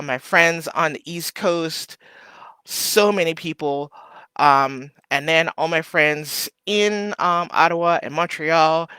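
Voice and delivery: ordinary talk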